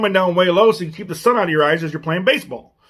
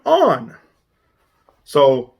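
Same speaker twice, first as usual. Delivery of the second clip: ordinary talk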